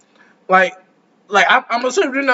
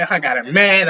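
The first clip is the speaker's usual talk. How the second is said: normal talking voice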